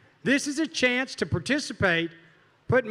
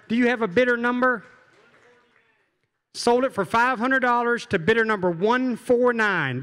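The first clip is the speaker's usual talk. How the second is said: ordinary talk, nothing marked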